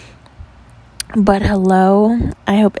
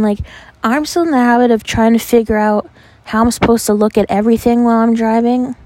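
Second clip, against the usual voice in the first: ordinary delivery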